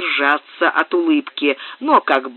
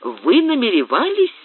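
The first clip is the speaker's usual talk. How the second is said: возмущённый возглас, выше; вскричать, вскочив с места в гневе; с негодованием; 9 semitones higher than usual. A narrator's reading. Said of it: "с негодованием вскричал маркиз". (негодующий выкрик)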